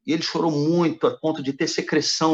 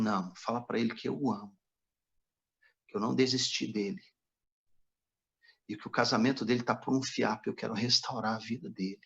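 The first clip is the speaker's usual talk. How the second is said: soft divine voice; 3 semitones lower, 6 dB quieter than usual. Retold or imitated. Holy Spirit speaks to him.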